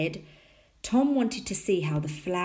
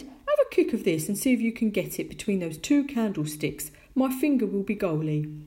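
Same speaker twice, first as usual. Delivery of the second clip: hearty fatherly voice, pitched up; Tom's dad; 3 semitones higher, 3 dB louder than usual. A narrator's reading. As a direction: set up a game for a young son. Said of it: "he said".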